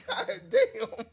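shocked delight; laughing amazed cry. Retold or imitated. Amazed retold exclamation.

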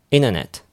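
"internet" said as "inner-net"; T dropped. Shown as a demonstration. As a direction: swallow the T so it's "innernet". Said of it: "イナーネットですよ". (「イナーネット」のように発音)

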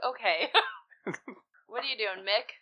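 ordinary delivery

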